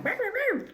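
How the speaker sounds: squeaky high burr sounds; high burr noises. Retold or imitated. Describing moving while eating, high squeaky burrs. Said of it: "I'm like".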